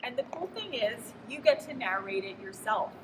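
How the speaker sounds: ordinary talk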